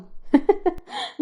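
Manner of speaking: high, soft giggling; amused laugh. A narrator's reading. Hayam hears of the wedding prank, amused laughter. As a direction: giggle at a silly story.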